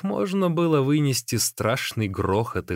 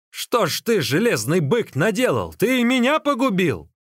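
high, emphatic accusing cry; shouted reproach; 7 semitones higher, 3 dB louder than usual. A narrator's reading. Magician blames runner for ruining him, shouted reproach. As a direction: scold him, pitch swooping up. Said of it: "крикнул Дайдзун".

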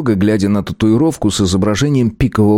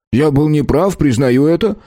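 hasty backpedal; 8 semitones higher than usual. A narrator's reading. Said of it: "быстро и резко переобулся".

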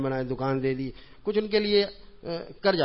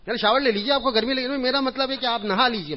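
higher, louder pleading host; insisting host; 8 semitones higher, 4 dB louder than usual. Retold or imitated. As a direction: press the guest kindly. Host voiced insisting on shower. (اصرار کرتا میزبان، اونچی آواز)

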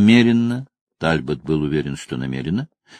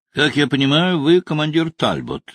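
put-on Russian spoken with an Irish accent; a genial Irish accent, lightly voiced; 7 semitones higher than usual. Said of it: "произнёс он низким голосом с явным ирландским акцентом".

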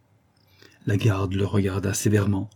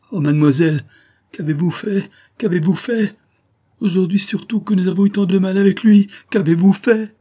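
panicked; 9 semitones higher, 5 dB louder than usual. Acted. Nurse panics at the opened door.

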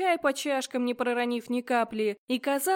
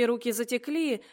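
plain reading voice

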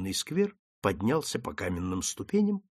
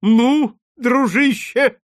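much higher, louder eager greeting; ardent; 12 semitones higher, 10 dB louder, much slower than usual. A narrator's reading. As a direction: hail him warmly and loudly. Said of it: "с жаром начал лорд Бомонт". (с жаром, гораздо выше и громче)

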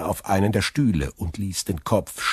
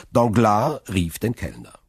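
ordinary delivery

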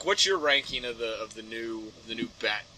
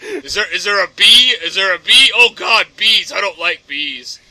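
loud, repeated alarmed questions; hammed-up panic at full volume; mock alarm; 3 semitones higher, 12 dB louder than usual. Batman misreads hand signs, loud mock alarm.

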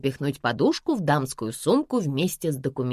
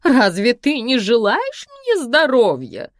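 high, loud, slow theatrical whine; a diva playing hurt; mother hamming up her wounded pride; capricious actress; 6 semitones higher, 5 dB louder, slower than usual. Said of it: "включила капризную актрису".

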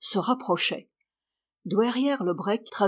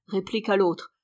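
ordinary delivery

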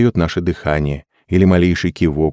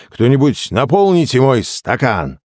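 high-pitched call across the table; 7 semitones higher than usual. A narrator's reading. Drinker demanding a refill from friends, calling out. Narrator's character calls for a drink.

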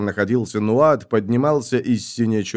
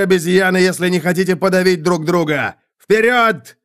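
high, loud, urgent commands; shouted battle orders; 9 semitones higher, 4 dB louder than usual. A narrator's reading. Commanders order a quick orderly retreat, high urgent shouting. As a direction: drive the troops on with yelled orders.

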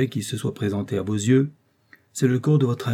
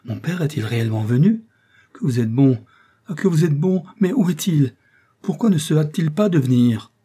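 high, louder, eager joy building; joyful; 6 semitones higher, 4 dB louder than usual. A narrator's reading. Son overjoyed his father has arrived, eager and high. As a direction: tumble out the words in delight. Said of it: "l'expression du plaisir succéda à celle du désespoir".

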